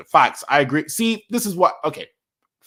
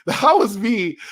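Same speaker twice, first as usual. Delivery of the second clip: slower, higher, louder, laughing through it; 7 semitones higher, 5 dB louder, slower than usual. Real, not acted. Streamer tells chat he was that fan, laughing, emphatic. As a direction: own it proudly with a laugh.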